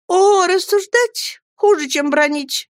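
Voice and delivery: put-on high, drawn-out childish wail; despairing cry. A narrator's reading. Dora dreads being reasoned with, despairing cry. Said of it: "закричала Дора в отчаянии".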